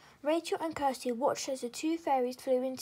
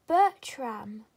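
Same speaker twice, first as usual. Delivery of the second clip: loud, delighted call of a name; excited cry; 2 semitones lower, 8 dB louder than usual. A narrator's reading. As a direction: call out in glad surprise. Fairy recognises her friend.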